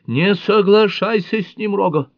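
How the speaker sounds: high, loud frantic scream; hysterical shout. A narrator's reading. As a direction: yell it out in sheer panic. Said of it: "истерично закричала Линда".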